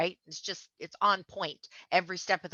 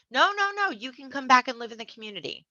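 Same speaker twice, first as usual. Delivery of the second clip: mock sweet community; 7 semitones higher than usual. Mocking community leaders' invitation.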